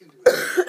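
harsh dry cough. Real, not acted. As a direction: cough hard. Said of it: "excuse my voice, my coughing".

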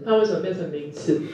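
ordinary talk

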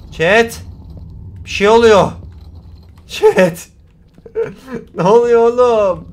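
loud, high calls for attention; alarmed yelling. Real, not acted. Streamer calling chat as the game glitches dark, alarmed. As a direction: call out in real alarm.

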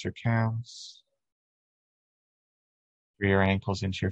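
unhurried, even meditation voice with long pauses; steady, calming body-scan delivery; guided meditation. Real, not acted. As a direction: guide listeners gently through breath and body.

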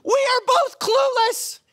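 high, slow accusing holler; exasperated shout in a retold fight; reenacted yell. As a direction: yell it at full force, stretching the words. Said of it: "here's what I wanted to yell".

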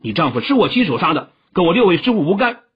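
calling out. A narrator's reading. The young hero shielding his teachers, fast and urgent. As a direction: blurt it out to protect the teachers. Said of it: "郭靖叫道".

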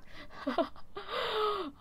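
long, soft, high-pitched laugh. Real, not acted. Speaker tripping over a hard word, helpless laughter. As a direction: burst into a giggle that trails off.

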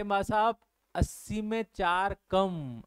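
put-on slow, drawn-out explanation for an old person; slow, patient-style imitation of explaining; explaining to elderly. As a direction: spell it out slowly, as if to an elder. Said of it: "उनको ऐसे ही बताना पड़ता है".

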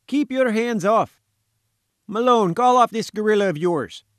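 high, loud indignant yell; sputtering protest. A narrator's reading. Visitor held by gatekeeper, yelling to Malone, sputtering protest. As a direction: protest loudly, voice pitched right up. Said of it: "he sputtered".

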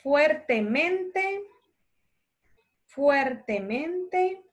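fuertemente drawn out slowly, word by word; slow dictation. Shown as a demonstration. Teacher dictating slowly to children copying notes. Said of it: "Les puedo ir leyendo despacito".

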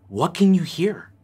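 ordinary talk

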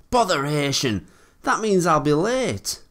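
high, loud grumble of annoyance; vexed exclamation. A narrator's reading. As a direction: fret loudly about being late. Thomas annoyed at being late.

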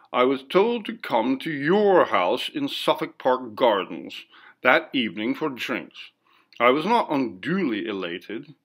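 plain reading voice

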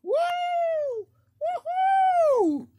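high excited whoops; cheering. Acted. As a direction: whoop like a hyped crowd. Contestants cheering entrance.

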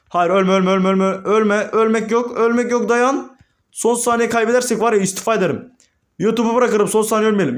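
high, urgent gamer panic; urgent high-pitched rush of pleas; frantic pleading. Real, not acted. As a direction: beg the team not to die, pitch up high. Frantic plea as the match slips away.

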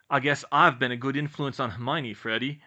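a hint of teasing at his twin; needling. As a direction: poke fun at his brother. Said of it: "George needled his twin".

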